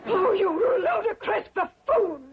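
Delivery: high, exasperated outburst; indignant wail. Acted. Guest insulting her clumsy admirer, indignant cry.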